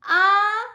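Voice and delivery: "Ah" held high and loud; high first tone. Shown as a demonstration. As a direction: sustain "Ah" on a high level note. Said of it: "the tones again".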